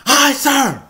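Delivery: loud, high recruit scream; screamed reply. Retold or imitated. Recruit screaming back at drill instructor, loud high shout. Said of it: "I scream back at him".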